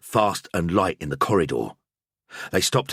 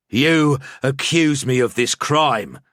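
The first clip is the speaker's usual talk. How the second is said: each word slow, weighty and louder; slow and emphatic; 6 semitones higher, 5 dB louder, slower than usual. A narrator's reading. Priest turning the accusation back on Grubheimer, slow and emphatic. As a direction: lay every word down deliberately. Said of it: "I said slowly and with emphasis".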